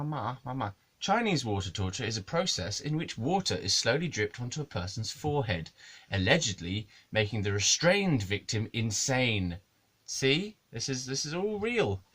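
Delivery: normal talking voice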